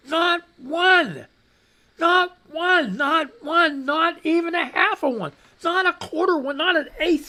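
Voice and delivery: high, loud, pointed ranting; furious counting down to nothing; angry rant. Real, not acted. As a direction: hammer each "not one" home in real anger. Angry political rant at viewers.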